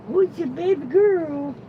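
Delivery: high falsetto coo to a dog; doting baby talk. Pretend. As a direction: pitch it way up and coo. Same words, cooed high to dog.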